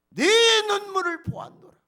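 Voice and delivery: proclaimed. Acted. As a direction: proclaim it like God's own declaration. Repeats God's words loudly. (크게 외치는 하나님의 말씀)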